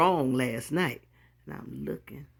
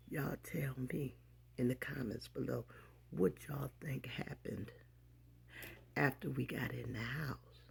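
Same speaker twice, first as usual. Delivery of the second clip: soft conspiratorial whisper; 3 semitones lower, 15 dB quieter than usual. Real, not acted. Vlogger whispering to viewers asking what happened next. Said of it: "whispered aside to viewers".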